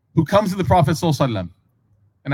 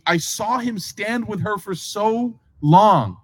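slow awed recall; 4 semitones higher, much slower than usual. Retold or imitated. Adi marvelling at the Prophet, slow and awed. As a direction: draw it out slowly, in wonder.

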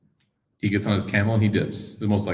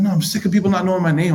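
annoyed friend; 9 semitones higher than usual. Retold or imitated.

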